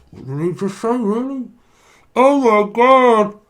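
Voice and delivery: high, stunned mock viewer voice. Psychic voicing a stunned viewer, high and amazed. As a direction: play a shocked viewer gasping.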